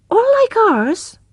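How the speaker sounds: high, slow, wide-eyed question; a small boy marvelling at the stars; wondering child. Acted. Little brother asking Tess about the stars, high and slow.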